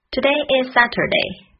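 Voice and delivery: "Today is Saturday" run together. Shown as a demonstration.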